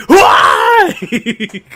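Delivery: high wailing cry through laughter; laughing mock wail. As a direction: cry it out while cracking up. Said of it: "at the end he goes".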